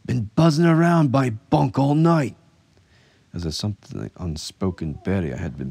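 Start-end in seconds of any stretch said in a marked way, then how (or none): 0.1-2.4 loud, high, agitated complaint; pressing. A narrator's reading. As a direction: insist loudly, thoroughly rattled. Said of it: "Skanto pressed".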